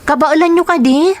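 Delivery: put-on a daughter pleading with her old father, pitched well up. Daughter worrying her father is ashamed on the road, high pleading voice.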